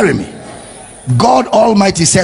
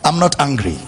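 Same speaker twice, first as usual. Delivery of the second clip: lower, calm reassurance; God not angry; 4 semitones lower, 5 dB quieter than usual. Acted. Preacher voicing God looking at his people, calm reassurance. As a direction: say it low and settled, as God reassuring. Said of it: "what God says now when he looks at you".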